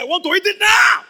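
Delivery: high, loud impatient yell; demanding shout. Acted. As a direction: shout with hunger, now. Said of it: "He said".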